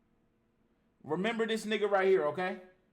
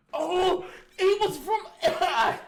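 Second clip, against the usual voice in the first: laughing; 10 semitones higher, 7 dB louder, slower than usual. Real, not acted. Laughing realization.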